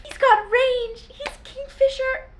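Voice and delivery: squealing delight. Real, not acted. Gushing to viewers about Kingfisher, high slow squeal. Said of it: "squealing delight".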